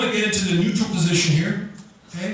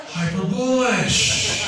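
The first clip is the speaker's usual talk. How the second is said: slow catchphrase; much slower than usual. Shown as a demonstration. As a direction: draw out hyper bullish slowly. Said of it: "what's that catchphrase".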